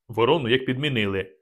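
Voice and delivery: plain reading voice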